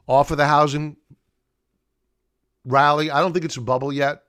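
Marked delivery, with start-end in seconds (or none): none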